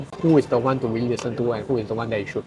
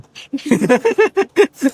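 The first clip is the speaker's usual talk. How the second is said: high laugh; 13 semitones higher, 5 dB louder than usual. Real, not acted. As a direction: burst of high-pitched laughter. Laughing at own story.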